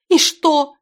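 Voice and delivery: high, loud desperate cry; hysterical despair. A narrator's reading. Girl answers the bastard's confession, hysterical and desperate. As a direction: throw it out high, as if through sobs. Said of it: "истерично, отчаянно, сотрясаясь от рыданий".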